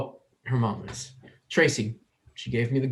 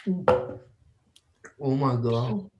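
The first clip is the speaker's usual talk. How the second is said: very slow, stunned oh my God; shocked; much slower than usual. Acted. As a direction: reel from the taste. Sam tastes the drink.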